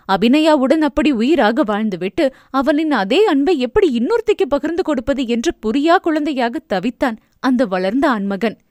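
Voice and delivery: ordinary delivery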